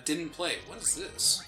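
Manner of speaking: normal talking voice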